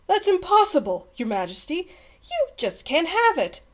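high-pitched, dismayed objection; shocked protest. A narrator's reading. Page boy telling the king it's impossible, shocked. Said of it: "Bartholomew gasped".

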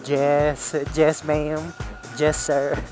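ordinary talk

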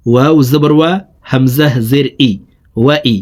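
ordinary talk, nothing marked

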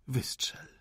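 breathy, hushed command to shoot; whispered plea. Acted. The wounded rebel whispers to the officer to fire. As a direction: beg him with your last breath.

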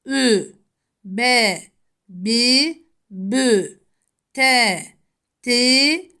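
each letter read with its three vowel marks, crisp and separated; syllable drill. Shown as a demonstration. Teacher drills Arabic letter sounds for pupils, crisp and separate. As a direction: model the syllables slowly for students. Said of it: "harflerin harekelerle okunuşu".

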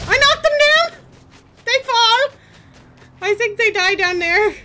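high, loud gleeful yelling; excited shout. Real, not acted. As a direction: cheer the kill. Gamer cheering a kill.

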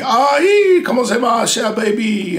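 high, loud Cajun DJ shout; cajun accent. Retold or imitated. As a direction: belt it out like a hyped Cajun radio DJ. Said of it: "where they spoke nothing but French".

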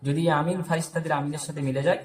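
usual speaking voice

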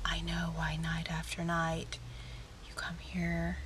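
breathy movie-heroine whisper, slow; hushed Matrix whisper, drawn out; whispered Trinity line. Retold or imitated. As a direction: whisper it like a mysterious heroine in a dark club. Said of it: "I always feel like Trinity from The Matrix".

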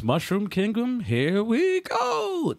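rising into a high, long-drawn "go"; imitating Mario. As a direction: launch into it like a game mascot. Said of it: "the only line he said was".

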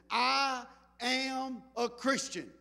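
slow, each word set apart; deliberate declaration. Retold or imitated. Preacher quoting what people are posting, deliberate declaration. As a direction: declare it slowly and firmly.

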